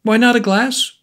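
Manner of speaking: high, sharp cry of protest; high indignant cry; cried out. A narrator's reading. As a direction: fling the question back, high. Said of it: "cried the dealer".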